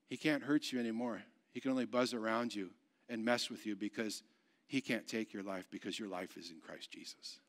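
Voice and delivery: soft, low, intimate sermon voice; hushed preaching. Real, not acted. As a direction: bring it down low to comfort the congregation. Preaches softly, applying the story.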